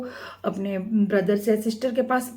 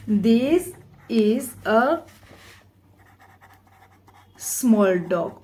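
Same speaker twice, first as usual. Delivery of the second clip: slow dictation; much slower than usual. Shown as a demonstration. Dictating the answer word by word.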